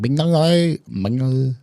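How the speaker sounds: high, bouncy mock Vietnamese accent. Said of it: "I could do an expert Vietnamese accent".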